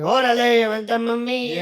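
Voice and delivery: put-on high, loud Mexican movie accent; hammed-up Mexican accent; over-the-top Mexican. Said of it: "watching a Mexican movie and everybody going".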